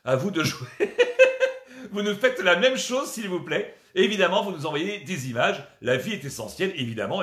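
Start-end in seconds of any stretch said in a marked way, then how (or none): 0.7-1.9 loud high cackle; real giggle after the joke; burst of high laughter. Real, not acted. Laughs after his bit.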